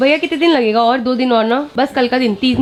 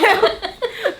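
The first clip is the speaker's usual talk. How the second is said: high amused laugh; 8 semitones higher than usual. Real, not acted. Telling the sleep story, laughing. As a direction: laugh at the memory.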